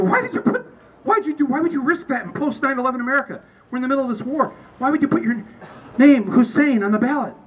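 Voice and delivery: high, hurried challenge; incredulous questioner. Retold or imitated. Somebody asks the candidate about his name, high and incredulous. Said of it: "Somebody asked him".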